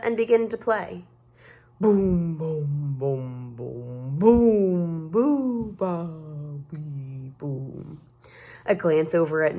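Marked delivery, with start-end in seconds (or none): 1.8-8.0 tuba noises. Acted. Imitating tuba playing.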